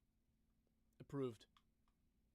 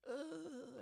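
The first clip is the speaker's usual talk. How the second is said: mock whiny complaint, much higher and slow; mocking whiner; 12 semitones higher, much slower than usual. Streamer mocking people who use eye drops, high slow mock whine. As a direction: whine it out like a big baby.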